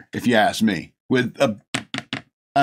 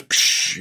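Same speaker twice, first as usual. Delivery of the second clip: throwing sound effect. Retold or imitated. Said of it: "throws the thing and goes".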